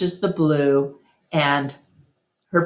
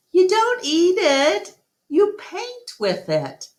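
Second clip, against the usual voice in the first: put-on high, amused girl voice; a girl gently correcting a friend, much higher; young girl's voice; 14 semitones higher than usual. A narrator's reading. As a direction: Julia laughing at the gorilla, high girlish voice. Julia laughs at the gorilla.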